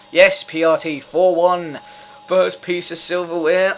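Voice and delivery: high, loud shout of victory; real elated cheer; triumphant celebration. Real, not acted. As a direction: yell it like the cup is won. Celebrates goal and trophy.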